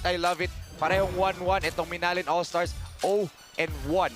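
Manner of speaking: usual speaking voice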